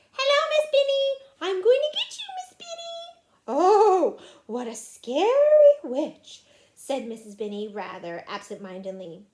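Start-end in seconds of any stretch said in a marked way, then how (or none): 0.1-3.2 put-on high, loud child's shout from behind a mask; muffled shout. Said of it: "shouted in a muffled voice". 3.5-6.4 loud, drawn-out pretend alarm; mock fright. Teacher playing along with masked child.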